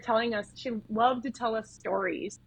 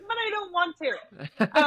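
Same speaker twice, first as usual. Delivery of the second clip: higher, drawn-out mock protest; mock whining protest; 8 semitones higher, slower than usual. Student objecting to her instructor's order, mock whining. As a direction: whine it playfully, drawn out and high.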